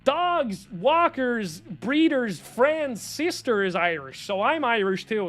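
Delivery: high, gushing mock American accent; bad American accent. Mimicking an American tourist claiming to be Irish, bad American accent. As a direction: put on an exaggerated American accent, stretching the words. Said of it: "I'm gonna do a bad American accent".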